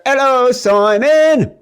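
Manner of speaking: put-on very high, drawn-out English-accent holler; yelled mock British accent. Host greeting his co-host Simon, yelled fake British accent. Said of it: "in, in accents".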